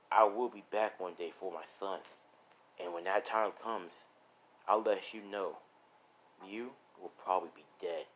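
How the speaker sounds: low, measured threat; menacing villain. Acted.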